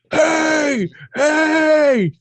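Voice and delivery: high mock yell of hey; retold yelling. Game wardens yelling at the parked driver, high mock yell. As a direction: call out like wardens in the dark. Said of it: "I hear men yelling".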